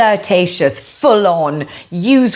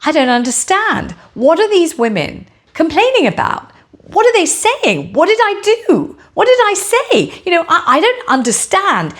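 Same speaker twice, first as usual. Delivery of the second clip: imitating bewildered Gino; 9 semitones higher than usual. Gino baffled by the women's complaints, protesting in a rising mock-bewildered voice. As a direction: play the baffled innocent, climbing in pitch with each question. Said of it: "hear Gino saying".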